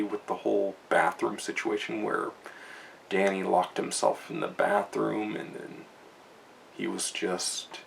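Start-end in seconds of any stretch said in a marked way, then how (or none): none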